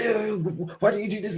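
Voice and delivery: high mock scolding; imitating uncle. Mocking the uncle nagging his son, high scold. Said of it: "I'm not gonna say his name".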